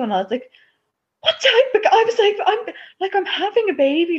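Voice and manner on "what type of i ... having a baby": high, strained blurting of the news; tearful blurt. Retold or imitated. Daughter blurting to her mom through tears, high and strained. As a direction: squeeze it out on the edge of tears.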